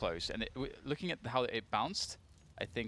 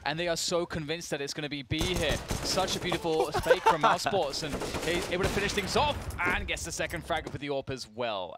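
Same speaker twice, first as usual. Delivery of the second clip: louder, higher hype; excited casting; 6 semitones higher, 6 dB louder than usual. Real, not acted. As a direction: shout the big play. Caster hyped by clever play.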